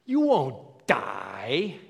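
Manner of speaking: loud, drawn-out sarcastic scoff; mock the very idea, stretching each word; imitating a scornful, sneering serpent. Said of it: "can you hear the sarcasm in his voice".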